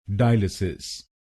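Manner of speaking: DIE-lisis, the wrong Indian way, stress on डाय; common mispronunciation. Shown as a demonstration. Teacher shows the wrong way people say it, DIE-lisis. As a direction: say डायलिसिस as DIE-li-sis, the common mistake. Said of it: "ज्यादातर लोग गलत बोलते हैं".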